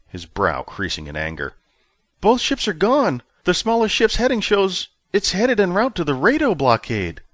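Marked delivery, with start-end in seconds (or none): 2.2-7.2 put-on much higher, louder young officer voice; officer reporting to his commander, high and tense; nervous junior officer. A narrator's reading. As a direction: report urgently like a rattled subordinate.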